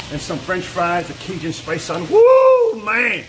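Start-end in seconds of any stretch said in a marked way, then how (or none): 2.1-3.2 high, loud whoop then man; excited shout. Real, not acted. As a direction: burst out cheering. Cheering at finished koi cakes.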